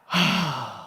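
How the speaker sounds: long low sigh out; big sigh. Shown as a demonstration. Teacher modelling a deep sigh for class. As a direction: let the breath fall out in a sigh. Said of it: "and sigh".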